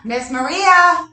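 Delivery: drawn-out call across the house. Real, not acted. Coach calling for the girl upstairs, drawn out. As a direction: call her name to reach upstairs.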